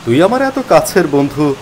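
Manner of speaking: plain reading voice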